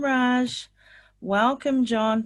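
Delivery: ordinary talk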